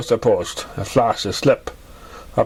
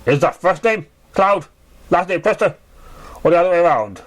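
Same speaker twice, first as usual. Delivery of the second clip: a hint of a Manhattan clerk, higher; lightly voiced bored clerk; 5 semitones higher than usual. A narrator's reading. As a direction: read it like a tired desk clerk with a Manhattan accent, pitched up. Mayor clerk voice in story.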